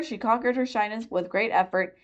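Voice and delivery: ordinary delivery, nothing marked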